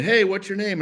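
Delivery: high, offhand quick greeting; busy autograph signer. Retold or imitated. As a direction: toss it out brightly while signing autographs. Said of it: "he's signing".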